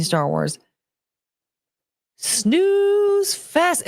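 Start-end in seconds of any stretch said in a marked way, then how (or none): 2.2-3.9 mocking jab. Real, not acted. Mocking Disney Star Wars.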